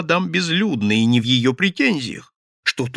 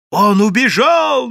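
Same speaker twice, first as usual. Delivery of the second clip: loud, high, stretched-out wail; straining shout; 10 semitones higher, 8 dB louder, slower than usual. A narrator's reading. Madame crying to the crowd that he fled, straining shout. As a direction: holler it at full strain. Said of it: "надрывалась мадам Безлюдная".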